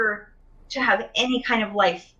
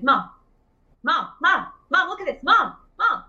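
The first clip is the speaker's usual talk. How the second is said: put-on a small child nagging for attention, pitched up; eager child calling; 7 semitones higher than usual. A four-year-old pestering mom to look at a drawing, eager and higher.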